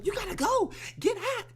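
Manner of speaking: high, loud shouting; frantic yell. Retold or imitated. Mother shoving the boyfriend out, high frantic yelling. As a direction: shout him out the door in a panic.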